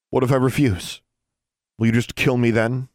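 daring the elf to strike; defiant challenge. Acted.

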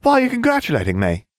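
very high, quick, airheaded voice; squeaky airhead voice; ditzy blonde witch. A narrator's reading.

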